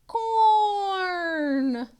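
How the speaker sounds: high and loud reveal; spring the answer; dramatic reveal. Acted.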